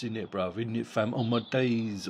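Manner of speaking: lightly imitated London roadman accent, sneering; mocking put-on London slang accent. Vlogger mocks a boxer's fake London accent, mild London roadman impression. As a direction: take the piss with a fake London street accent. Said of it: "That talking like this".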